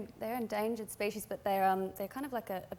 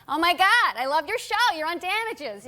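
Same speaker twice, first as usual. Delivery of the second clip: loud, high-pitched excited fan; gushing fan; 7 semitones higher, 10 dB louder than usual. Retold or imitated. Woman accosting actress in local coffee shop, gushing. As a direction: imitate an over-excited fan gushing loudly. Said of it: "had a woman recently come up to me".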